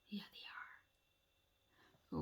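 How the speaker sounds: slow hushed whisper. Real, not acted. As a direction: breathe it almost silently. Whispered aside to children.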